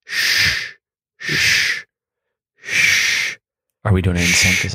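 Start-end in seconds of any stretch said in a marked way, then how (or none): 0.0-3.4 soothing shushing. Pretend. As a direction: shush gently as if calming a sleeper. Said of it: "Let's do that for a little bit for the sleepers".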